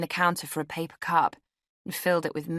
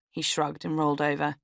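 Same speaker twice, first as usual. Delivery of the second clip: ordinary delivery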